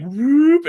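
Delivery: high rising whoop sound; mock whoop. Friend retelling the attic kill, rising whoop. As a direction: swoop the voice up like a body yanked away.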